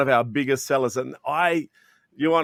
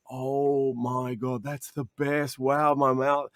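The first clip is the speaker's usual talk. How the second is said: amazed customer; 3 dB quieter, slower than usual. Retold or imitated. Customer tasting chocolate, amazed.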